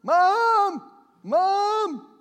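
high, loud frantic calls; a small child shouting for mom in panic; calling for mom. Retold or imitated. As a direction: yell for your lost mother across the store. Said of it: "walking through the store crying out".

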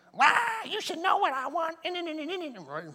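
high, hammed-up wail into gibberish; spouse blowing up. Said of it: "and it just blew up".

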